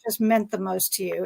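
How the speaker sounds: ordinary delivery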